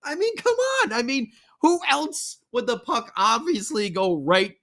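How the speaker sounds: high mock-exasperated voice; sarcastic disbelief. Real, not acted. Fan complaining about the turnover to Pastrnak, sarcastic high voice.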